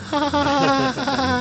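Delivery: high mock nervous laughter. Caught out before a live commentary, mock nervous laugh. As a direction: fake a panicked laugh, ha ha ha. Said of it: "And I'm like".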